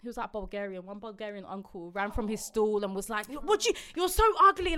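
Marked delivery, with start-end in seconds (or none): 3.3-4.8 high, loud insult; retold shouting. Stall uncle insults the aggressive woman, retold high shout. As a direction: imitate a man yelling abuse. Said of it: "like, shouting at her".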